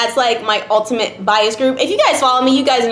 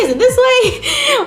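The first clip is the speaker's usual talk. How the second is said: much higher, drawn-out puzzled ask; confused high question; 13 semitones higher, slower than usual. Retold or imitated. Asking friends which way the pose goes, confused and high. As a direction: squeak the question out, lost. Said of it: "confused, like".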